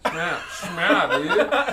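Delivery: loud, high, laughing through it. Real, not acted. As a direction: crack up while saying it. Mocking a funny surname.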